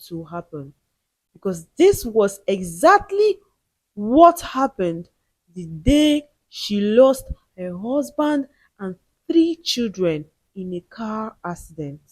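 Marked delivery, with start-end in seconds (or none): none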